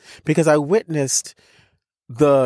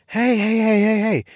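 high, repeated hushing call; soldier's calming call; 8 semitones higher than usual. Retold or imitated. Soldier to a panicking traveler, high calming call. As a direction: imitate someone heading off a panic, pitched well up.

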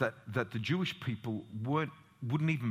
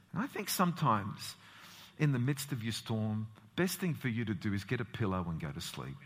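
normal talking voice

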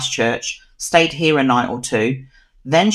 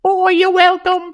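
put-on Australian accent. Joking reply as Charlotte.

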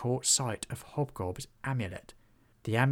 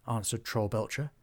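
plain reading voice

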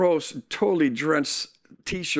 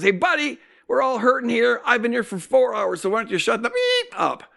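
higher, gruff retort mimicking a fed-up patient; imitated angry shout; 6 semitones higher than usual. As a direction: snap back at the whiner, telling him to shut up. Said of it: "he shouted it".

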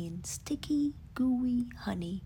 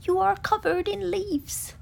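high, louder amazed remark; amazed Rabbit; 9 semitones higher, 8 dB louder than usual. Acted. Rabbit tells Pooh he is covered in leaves. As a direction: point out the obvious.

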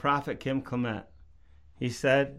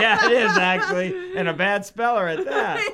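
laughing; 6 semitones higher, 6 dB louder than usual. Real, not acted. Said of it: "That's pretty darn amazing".

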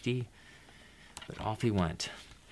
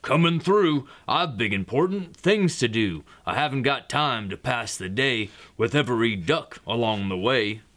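truck voice; 2 semitones higher, 4 dB louder than usual. Acted. Dump truck with important things to do, sing-song truck voice. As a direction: chant it like a self-important truck. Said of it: "That's my truck voice".

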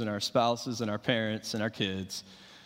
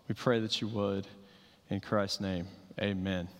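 prayer; 3 semitones lower than usual. Acted. Pastor leads a prayer.

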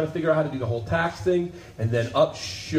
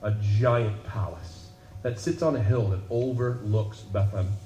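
low, soft sermon close; reflective preaching; 6 semitones lower, 5 dB quieter than usual. Acted. Preacher closing the message softly over music. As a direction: draw them in quietly.

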